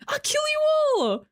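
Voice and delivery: a hammed-up cartoon baddie threat; mock villain.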